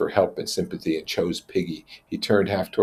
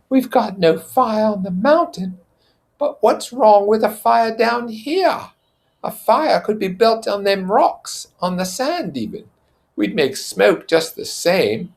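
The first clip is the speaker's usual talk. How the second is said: put-on high, loud, slow reasoning boy; Piggy's voice; 4 dB louder than usual. Piggy proposing a fire down on the beach. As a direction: argue the point patiently.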